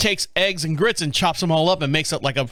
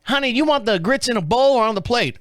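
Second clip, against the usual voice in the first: waitress voice; 8 semitones higher than usual. Retold or imitated. Voicing the waitress.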